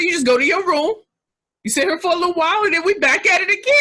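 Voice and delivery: put-on high, loud grandmother setting a child straight; imitated grandmother. Grandmother's scolding, acted out.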